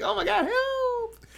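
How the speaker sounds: very high, slow, hammed-up plea; mock cry for help. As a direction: wail it like a cartoon victim. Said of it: "He just got dragged out of the room like".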